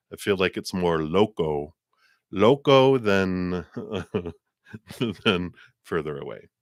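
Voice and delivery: ordinary talk, nothing marked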